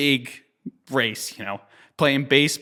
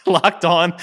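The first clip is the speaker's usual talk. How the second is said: laughing; 5 semitones higher, 6 dB louder than usual. Real, not acted. Laughing while recalling the race.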